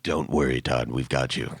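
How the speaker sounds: a calm hint of a god reassuring. God reassuring Todd onstage, lightly voiced low calm. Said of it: "the God said".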